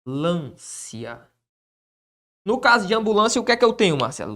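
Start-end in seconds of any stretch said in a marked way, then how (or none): 0.0-1.3 slow, soft dictation to the board; dictating while writing. Shown as a demonstration. Teacher dictating the rule as he writes it, slow and soft. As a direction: spell it out slowly as you write.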